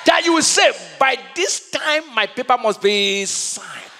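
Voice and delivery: high, loud prophetic proclamation; preaching declaration. Real, not acted. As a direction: proclaim it over the congregation, stretching key words.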